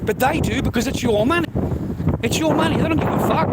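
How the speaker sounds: a fed-up husband venting about his wife's spending; heated rant. Real, not acted. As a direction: push it up in exasperation. Ranting peak.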